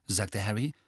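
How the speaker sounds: plain reading voice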